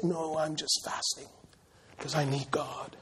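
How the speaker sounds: hushed, self-pitying mock reply; mock mopey fasting. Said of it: "talking like this".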